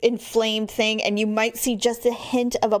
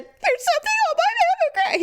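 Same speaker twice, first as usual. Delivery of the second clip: high, frantic wail; panicky. Retold or imitated. Wife wailing to her husband Daryl in high mock panic. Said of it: "And I said".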